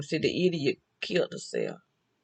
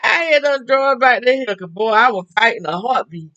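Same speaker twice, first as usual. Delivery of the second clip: laughing through words; 7 semitones higher, 8 dB louder than usual. Retold or imitated. Laughing retelling of near fight.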